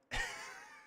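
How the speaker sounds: high-pitched delighted squeal of a laugh; high giggle of delight. Real, not acted. Delighted by the AI movie.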